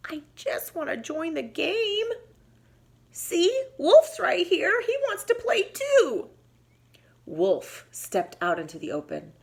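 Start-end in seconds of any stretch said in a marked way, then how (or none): 0.1-6.3 a hint of high pleading whimper; lightly whimpering. Bobcat mildly whimpering to Bear to join the game. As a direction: mildly whine to be let in. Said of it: "Bobcat whimpered".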